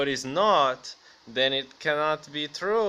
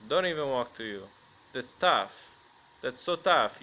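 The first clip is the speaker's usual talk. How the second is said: ordinary delivery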